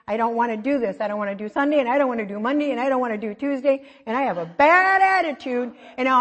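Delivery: listing the days in a hammed-up whine; whiny complaint. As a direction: play up the weary protest. Tired speaker listing dreaded days.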